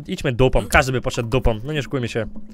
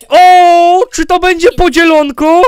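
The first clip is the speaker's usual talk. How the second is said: excited; 8 semitones higher, 10 dB louder, slower than usual. Real, not acted. Excited at the sharing.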